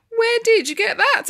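high, loud astonished question; amazed. Acted. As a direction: exclaim it as the whole astonished family. Family's amazed question acted.

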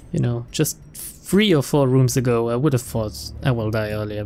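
ordinary delivery

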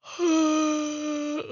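long open-mouthed yawn, high and loud; real loud yawn. Real, not acted. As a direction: let a big real yawn out. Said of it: "Sorry, I yawn again".

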